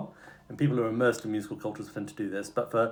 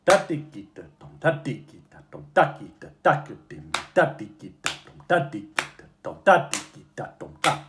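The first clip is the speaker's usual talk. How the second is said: "Da" punched at the start of each group; accent the first syllable; 3 semitones higher, 4 dB louder than usual. Shown as a demonstration. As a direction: hit the first beat of every group. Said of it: "accent the tas, so the beginning of each rhythmic grouping".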